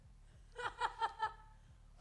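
high, loud burst of laughter. Real, not acted. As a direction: let out a big laugh. Laughs at book joke.

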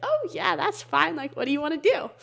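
high, breezy mock mom voice, lightly voiced; imagined easygoing mom. Son imagining his mom's easy answer, a light high mock breezy voice. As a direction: play the carefree mom who says sure, stay home. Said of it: "thinking she would be like".

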